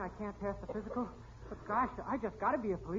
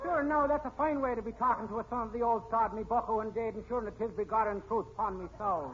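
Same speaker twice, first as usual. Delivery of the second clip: Irish brogue. Acted. Rookie fakes Irish brogue to win over the captain. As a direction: lay on the Irish brogue to pass as Irish.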